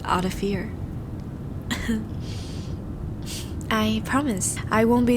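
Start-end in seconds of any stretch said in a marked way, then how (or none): 0.8-3.7 quiet unvoiced laugh under the breath; soft breathy laugh. Vampire lightening the mood with his date, breathy soft laugh. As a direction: laugh off the gloom, barely audible. Nervous laugh after a gloomy speech.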